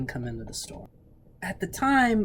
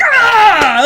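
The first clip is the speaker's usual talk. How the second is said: frustrated shout; 18 dB louder than usual. Retold or imitated. Frustrated outcry, retold.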